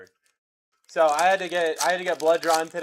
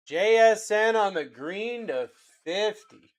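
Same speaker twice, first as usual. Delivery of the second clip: drawn-out call; slower than usual. Acted. Repeats the card call, drawn out.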